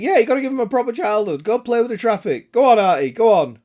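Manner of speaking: playful hearty goading; mock egging on. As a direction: cheer the toddler on in jest.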